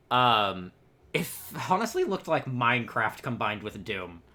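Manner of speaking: ordinary delivery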